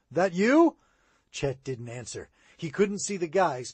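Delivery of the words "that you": loud questioning call. A narrator's reading. Boy searching the creek for his friend, calling out. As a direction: shout the question toward the reeds. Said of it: "Sid called".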